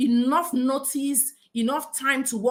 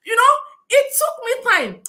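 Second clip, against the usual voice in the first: high, loud laughing words; 11 semitones higher, 6 dB louder than usual. Real, not acted. Recalling her first weeks at work, laughing. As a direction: laugh it out, still amused.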